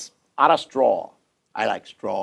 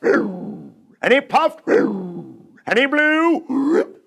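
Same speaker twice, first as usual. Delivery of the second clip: drawn-out blowing; 7 semitones higher, much slower than usual. Acted. Wolf blowing the house.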